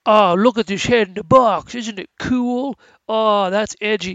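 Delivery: imitating a teenager showing off something edgy; fourteen-year-old boy. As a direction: put on an easily thrilled teen boy. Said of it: "like, a fourteen-year-old boy inside David Fincher".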